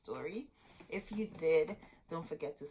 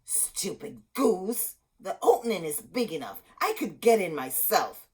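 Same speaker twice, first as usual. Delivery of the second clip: hissed, then loud mocking witch voice; scornful witch; 2 semitones higher, 8 dB louder than usual. A narrator's reading. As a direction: snap at the girl like a sneering witch. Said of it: "The witch cackled".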